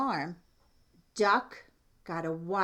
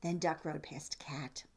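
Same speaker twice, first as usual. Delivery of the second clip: ordinary delivery, nothing marked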